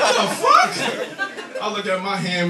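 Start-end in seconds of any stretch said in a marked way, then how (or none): 0.0-0.7 very high, louder mock shock; shocked squeal. Comic watching the joint melt, high shriek. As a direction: shriek it, startled.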